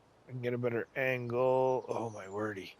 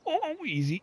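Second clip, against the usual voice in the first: high squeak of alarm; squeaky oh. Real, not acted. Player nervous over the edit, squeaky oh. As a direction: let the oh jump up.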